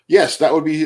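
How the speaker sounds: usual speaking voice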